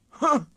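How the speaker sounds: a loud, high scornful grunt. A narrator's reading. Sir Handel grunting in scorn at the music. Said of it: "grunted Sir Handel".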